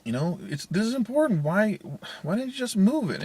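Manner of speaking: high-pitched, loud exasperated scolding; pleading annoyance, voice pushed up; upset parent. Retold or imitated. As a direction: re-enact a frustrated snap at your kid. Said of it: "a little bit more upset than what I just did".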